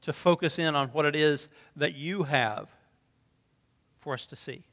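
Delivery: soft, low closing prayer. Acted. Pastor closing the prayer before preaching, soft and low. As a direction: pray quietly to close.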